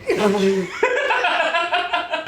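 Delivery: a long high laugh at himself. Real, not acted. Laughing at the joke that he was boring on the first show. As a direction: laugh off the self-mockery.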